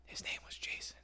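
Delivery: hushed horror-movie whisper; creepy whisper. Retold or imitated. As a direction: whisper "his name was Jason" breathy and eerie. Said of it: "Just remember".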